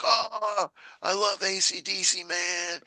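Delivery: high, gushing fan voice; excited fan voice. Retold or imitated. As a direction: gush about the band up high. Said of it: "He's like".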